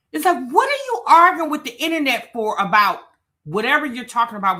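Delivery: exasperated. Real, not acted. Exasperated rant at commenter's arguing.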